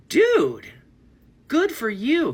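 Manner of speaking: high, loud delighted cheer; excited cheer. Retold or imitated. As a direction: cheer her on at the top of your voice. Cheering the girl on.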